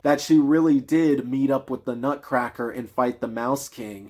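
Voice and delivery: ordinary talk, nothing marked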